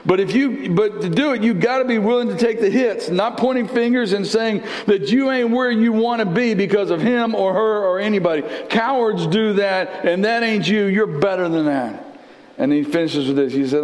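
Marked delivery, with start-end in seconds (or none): 0.1-12.1 raised, driving preacher voice; fervent preaching. Acted. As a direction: preach the promise with fire. Preaches the Rocky lesson.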